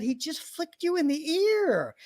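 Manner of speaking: skeptical friend. Retold or imitated. Friend doubting the chiropractor's flick, slow disbelief. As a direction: spell out the obvious in disbelief.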